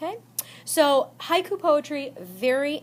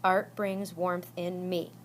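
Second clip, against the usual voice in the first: "Art brings warmth in me" softer, lower, in five slow beats; syllable by syllable; 4 semitones lower, 6 dB quieter, slower than usual. Shown as a demonstration. Teacher repeating the line to count syllables, "Art brings warmth in me" slow and soft. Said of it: "Five syllables".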